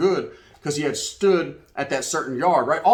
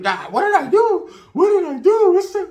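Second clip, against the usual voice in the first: mock pleading victim; 7 dB louder than usual. Storyteller mimics a scared guy begging the gang.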